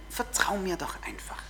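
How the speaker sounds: soft whispered plea; intimate whisper. Acted. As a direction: whisper it like a promise. Gottes Stimme, eindringlich.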